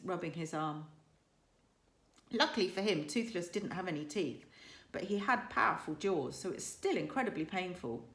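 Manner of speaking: ordinary delivery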